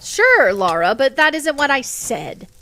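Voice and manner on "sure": high, loud sarcastic sure. Real, not acted. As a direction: fling it back in disbelief. Protesting a friend's teasing.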